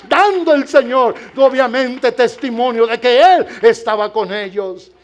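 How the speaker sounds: loud, soaring preacher's cry; fiery preaching. Real, not acted. Pastor preaching to the church, loud and fervent. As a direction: preach it full force to the congregation.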